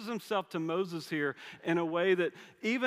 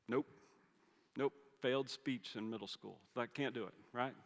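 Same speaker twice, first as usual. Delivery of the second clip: softer, lower, a touch sheepish; Moses pleading; 3 semitones lower, 4 dB quieter than usual. Retold or imitated. Moses admitting to God he can't speak, softer and lower. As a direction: confess it quietly, embarrassed.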